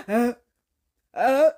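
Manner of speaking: mimed build-up to a sneeze; about to sneeze. Retold or imitated. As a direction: gasp as if a sneeze is coming. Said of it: "about to sneeze".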